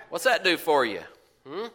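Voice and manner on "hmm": ordinary talk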